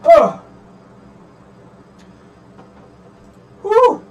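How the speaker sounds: loud pained cries; yelping at a leg cramp. Real, not acted. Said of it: "you're dying over here".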